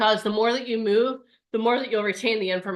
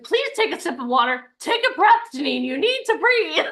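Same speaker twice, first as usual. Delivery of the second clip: mock Bradley; 6 semitones higher, 3 dB louder than usual. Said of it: "Bradley's like".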